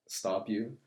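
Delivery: ordinary talk, nothing marked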